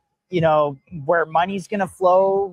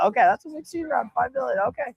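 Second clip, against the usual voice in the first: higher and fast, amazed at the number; quick, high, impressed double take; impressed surprise; 7 semitones higher, faster than usual. Real, not acted. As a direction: let the size of the round sink in with a raised voice. Host reacts to big ask.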